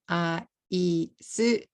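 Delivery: "aisu" in three separate beats, a-i-su; katakana English. Shown as a demonstration. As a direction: add a u after the s in "aisu". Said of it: "If I say this in katakana".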